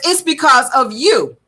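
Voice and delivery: slow, emphatic imitation of her retort; stretched-out, pointed delivery. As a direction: draw out the comeback, mimicking her. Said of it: "that's how she said it".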